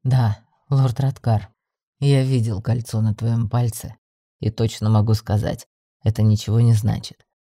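deep, assured male character voice; persuasive male lead. Acted. Йен persuading the heroine to choose him, deep and insistent. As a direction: press the case in a low, steady voice.

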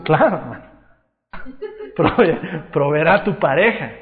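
ordinary talk

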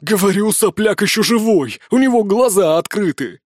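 alarmed insistence. Acted. Thug alarmed, insists to partner.

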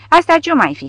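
high, loud, irritated question; furious snap. A narrator's reading. Colonel startled by shelling in his courtroom, snapping angrily. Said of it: "se răsti furios colonelul Schmidt".

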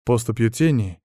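plain reading voice

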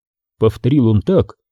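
plain reading voice

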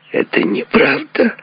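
tight, high, gritted-teeth protest; through gritted teeth. A narrator's reading. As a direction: deny it tensely, drawing it out. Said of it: "скрипнув зубами".

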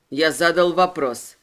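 plain reading voice

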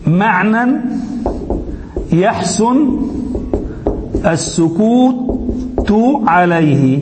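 slow, one word at a time with long pauses; word-by-word dictation. Shown as a demonstration. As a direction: dictate it, pausing after every word. Teacher dictates the definition word by word.

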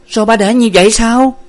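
high, loud, unreasonable snap; cross outburst. A narrator's reading. Daughter to her father over her stricken mother, cross outburst. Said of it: "Nguyệt gắt lên một cách vô lý".

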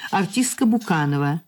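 ordinary talk

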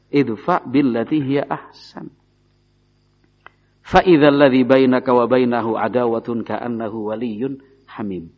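measured melodic Quran chant; Quran recitation. Acted. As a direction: recite it like tilawah, drawing out the vowels.

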